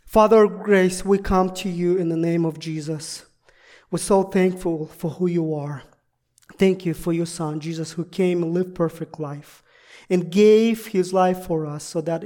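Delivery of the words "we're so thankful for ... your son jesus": ordinary talk, nothing marked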